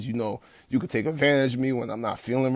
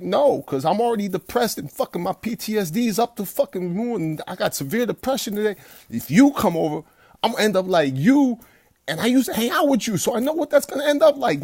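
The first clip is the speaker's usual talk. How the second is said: much higher and louder, fired-up pushback; retold heated refusal; 8 semitones higher, 5 dB louder than usual. Telling his old friend no, a fired-up retort. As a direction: throw it back at him, worked up and defiant. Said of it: "I'm like".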